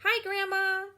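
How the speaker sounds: light up at hearing grandma; excited greeting. Acted. [name] greets grandma, high and excited.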